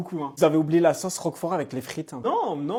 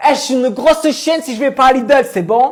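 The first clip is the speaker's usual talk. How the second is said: catchphrase; 6 semitones higher, 7 dB louder than usual. Retold or imitated. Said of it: "je lui dis".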